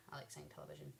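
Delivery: whispered playful aside; whispering. Real, not acted.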